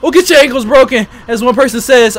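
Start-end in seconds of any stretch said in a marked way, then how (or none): none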